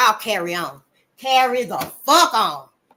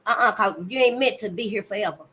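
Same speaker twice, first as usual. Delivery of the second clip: normal talking voice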